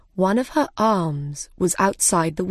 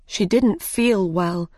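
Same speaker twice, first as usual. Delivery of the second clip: ordinary delivery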